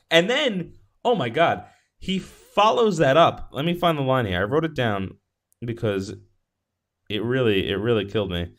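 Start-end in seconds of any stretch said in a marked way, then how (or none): none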